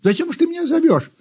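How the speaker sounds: high, quick kitten question; kitten calling down. A narrator's reading. Kitten on the roof to the puppy, demanding. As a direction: ask it fast in a high voice. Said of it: "спросил Гав".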